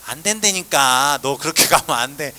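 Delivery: a hint of a teacher laying down the law; stern teacher. Teacher slowly and firmly refusing the student's school choice, mock stern.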